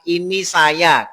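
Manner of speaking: high, slow mock reply of a visitor; wrong reply. Preacher mimics a guest answering the door, high and slow. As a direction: drag out the lazy answer. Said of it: "Larangan mengatakan".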